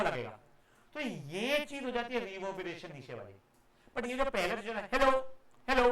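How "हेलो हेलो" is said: short hello, gap, same short hello back; echo demo. Shown as a demonstration. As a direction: imitate a clean, distinct echo. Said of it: "तो ये हो जाता था बेटा एको". (गूंज जैसे दो अलग हेलो)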